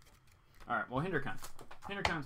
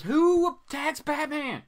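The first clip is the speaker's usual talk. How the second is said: high, loud incredulous squeal; mock disbelief; 13 semitones higher, 6 dB louder, slower than usual. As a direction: play up the disbelief.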